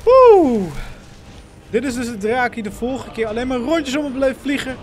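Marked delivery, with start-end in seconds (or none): 0.0-0.7 loud startled whoop; a loud high yelp; excited yelp at the dragon. Real, not acted. Dragon appears.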